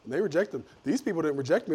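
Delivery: ordinary talk, nothing marked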